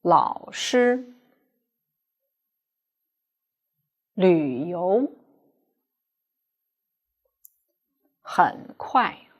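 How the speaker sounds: slow teacher model of 老师, 旅游, 很快; half third tone demo. Shown as a demonstration. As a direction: read it out for students to repeat. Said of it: "pronounce half of the first third tone".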